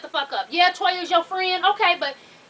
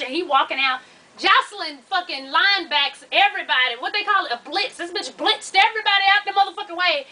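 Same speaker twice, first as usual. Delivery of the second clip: normal talking voice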